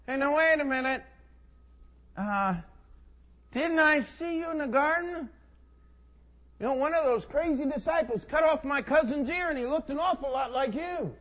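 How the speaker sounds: high, loud, suspicious challenge; loud accusation. Acted. Cousin challenges Peter by the fire, loudly accusing. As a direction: confront him, pitched well up.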